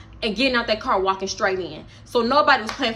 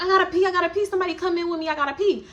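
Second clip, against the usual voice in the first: high, urgent whining; high urgent whine; 7 semitones higher, faster than usual. Retold or imitated. Drunk friend urgently needs toilet.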